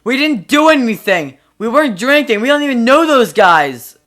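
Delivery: high, loud panicked protest; freaked out yelling. Acted. Jim to the old man, panicked high shout. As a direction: yell your innocence in a panic. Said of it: "Jim gets all freaked out and starts yelling".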